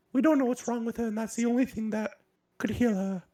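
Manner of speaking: very high-pitched little gnome woman; high falsetto, urgent gnome messenger; gnomish woman. Acted. Gnome woman urges Nar to come to his mother, high voice.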